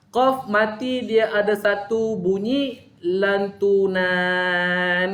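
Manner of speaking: drawn-out, careful words; slow explaining. Shown as a demonstration. Ustaz explaining the bouncing qaf, slowly. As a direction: spell it out slowly for students. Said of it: "untuk makluman anda".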